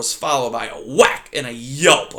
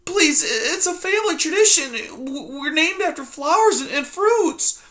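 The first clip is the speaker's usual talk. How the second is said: put-on high, small, timid mouse girl; timid young mouse. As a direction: squeak it out like a frightened little mouse. Poppy voiced in story.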